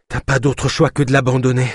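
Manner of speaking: breathless advice from running; winded murmur. A narrator's reading. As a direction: speak between wheezing breaths after running. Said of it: "murmura Naya entre deux expirations sifflantes".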